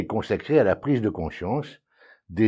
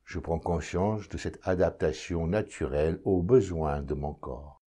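calm, measured meditation voice, lower and softer; guided meditation; 3 semitones lower, 4 dB quieter than usual. Acted. Meditation guide leading listeners to notice their breathing, calm and unhurried. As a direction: guide the listener inward, with long silences between phrases.

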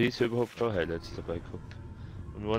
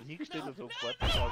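laughing. Real, not acted. Lachend erzählt.

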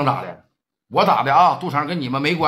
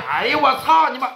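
pained curse; 8 semitones higher than usual. Acted. Glasses knocked off, cursing.

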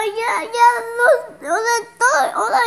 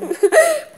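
teary little giggle; laughing while still crying; laugh through tears; 5 semitones higher than usual. Real, not acted.

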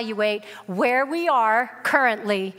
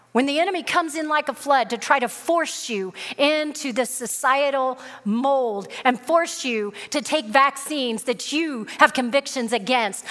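ordinary delivery

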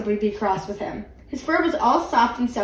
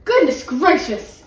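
exclaimed; 4 semitones higher, 5 dB louder, slower than usual. A narrator's reading. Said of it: "exclaimed Missus Bird".

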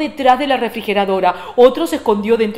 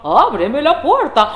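slow, higher sing-out as fake mother; wolf's disguised voice; 4 semitones higher, slower than usual. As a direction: stretch it sweetly, swooping up. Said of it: "la voz le salió diferente".

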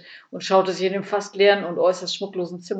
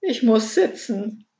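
slow, higher, overcome moan; faint groan; 4 semitones higher, slower than usual. A narrator's reading. Burglar needs a seat after the news, groaning. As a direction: groan it slowly, as if wilting. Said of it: "stöhnte Ede noch matter".

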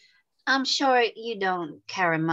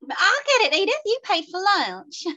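very high, louder squeaky eagerness; eager high voice; 11 semitones higher, 4 dB louder than usual. Acted. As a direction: jump in to pay. Lucy Nell eagerly snatches polish.

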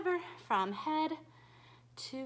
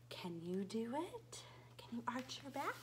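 whisper; 3 semitones lower, 10 dB quieter than usual. Acted. Whispered prompts to children.

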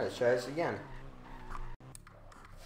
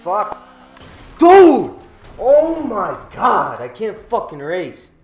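loud exasperated shout; frustrated yell; 8 semitones higher, 17 dB louder, slower than usual. Real, not acted. Race lost, yelling in frustration.

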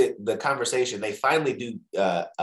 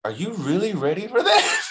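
raised-pitch self-questioning; mock doubting question; 9 semitones higher, 4 dB louder than usual. Acting out reality setting in about New York job, doubting. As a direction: ask it high, as an acted inner doubt.

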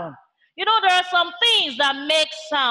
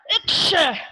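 staged "achoo" sneeze; demonstrated sneeze. Shown as a demonstration. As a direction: fake a short sneeze. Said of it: "you hear the sneeze".

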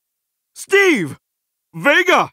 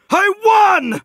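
high, exultant victory cry; triumphant shout; 6 semitones higher than usual. Acted. Hero celebrating a won fight, triumphant shout. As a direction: shout it out, thrilled at winning.

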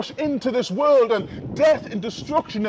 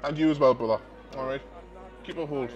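quiet aside; 8 semitones lower, 6 dB quieter, much faster than usual. Real, not acted. Preacher chats with a listener.

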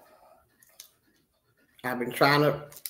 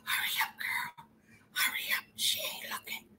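hushed, urgent whisper; sneaky whisper; 14 dB quieter than usual. Acted. Accomplice behind Jenny urging her on, sneaky whisper. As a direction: whisper it like a lookout.

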